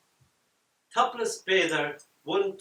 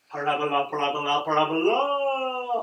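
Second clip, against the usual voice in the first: hollering ball; 7 dB louder than usual. A narrator's reading. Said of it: "hollers the ball".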